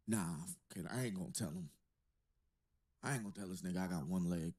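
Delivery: low, casual scheming to oneself; imagined secretive schemer. Pretend. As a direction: play it low and sly, lightly. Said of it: "I just imagine these people sitting at the crib".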